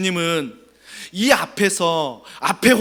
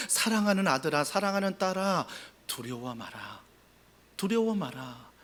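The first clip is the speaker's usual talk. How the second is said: soft, fading tender voice of a father; tender reassurance; 3 semitones lower, 11 dB quieter than usual. Acted. God speaking softly to his children, tender and soft. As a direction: hush your child's fear gently. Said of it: "하나님이 아시니까 뭐라고 말씀하세요".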